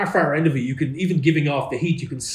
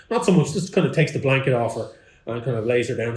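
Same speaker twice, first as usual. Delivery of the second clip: ordinary talk, nothing marked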